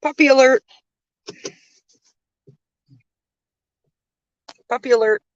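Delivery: high, playful alert call; puppy alert call. Real, not acted. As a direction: announce the puppy with delight. Spotting a puppy.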